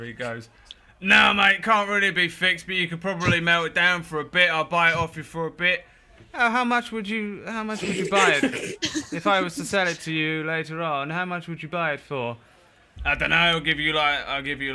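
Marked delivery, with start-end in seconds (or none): 1.0-5.8 high, loud, shouted reply from across the room; Tanter shouting back. Acted. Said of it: "And Tanter shouts over". 6.3-14.7 high, loud haggling voice; bargaining shopkeepers. Acted.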